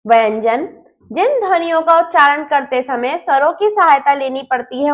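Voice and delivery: ordinary delivery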